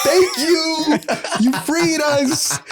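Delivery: high, mock grateful, the last part slow with a laugh; mock thanking America with a laugh; sarcastic thanks. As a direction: overdo the gratitude ironically.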